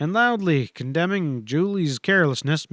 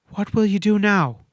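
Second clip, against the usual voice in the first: strained voice; 6 semitones higher, 3 dB louder than usual. A narrator's reading. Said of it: "she managed in a strained voice".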